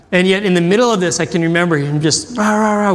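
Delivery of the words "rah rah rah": higher wordless mock tirade; imitated ranting. Preacher remembering the man's words going off, mock ranting. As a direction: mimic someone going off in nonsense syllables.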